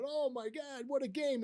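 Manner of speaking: high-pitched imitation of excited gushing; excited general manager. General manager congratulating his manager by phone, excited. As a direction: gush like an overjoyed boss. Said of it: "as excited as I've ever heard him".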